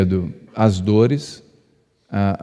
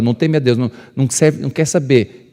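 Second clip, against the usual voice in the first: ordinary delivery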